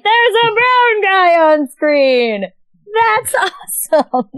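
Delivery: high, drawn-out mock-gushing; re-enacted excitement. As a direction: stretch it out in high, delighted awe, replaying your younger self. Recalls own delight seeing the film.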